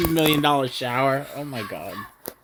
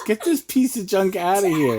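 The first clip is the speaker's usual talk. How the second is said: yelling across the room; 7 semitones higher than usual. Real, not acted. Dad reacting to helicopter.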